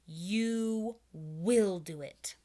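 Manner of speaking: very slow, firm order; commanding. Shown as a demonstration. Teacher showing a firm command, very slow. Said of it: "He's saying".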